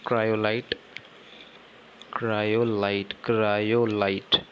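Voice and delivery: stretched out slowly, syllable by syllable; slow dictation. Shown as a demonstration. Teacher dictating an ore name to students, slowly. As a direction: spell it out slowly so the class can write.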